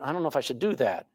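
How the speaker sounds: second-guess yourself under your breath; hesitant inner voice. Retold or imitated. A person about to act hesitates, a soft, quick doubtful mumble.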